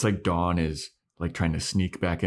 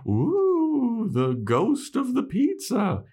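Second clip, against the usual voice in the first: high, drawn-out mock spooky wail; silly spooky ghost voice, pitched way up; playful ghost; 4 dB louder, much slower than usual. Acting out invisible prankster playing ghost.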